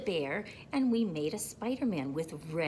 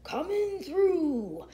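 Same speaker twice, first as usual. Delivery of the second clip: high, loud, stretched-out call; yelling; 9 semitones higher, 7 dB louder, slower than usual. A narrator's reading. Dump truck barges through, yelling. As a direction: holler it slowly to clear the road. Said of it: "yelled a dump truck".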